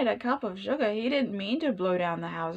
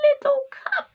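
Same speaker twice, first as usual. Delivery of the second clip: squeaky plea; 5 dB louder than usual. Acted. Pleading for a cup of sugar.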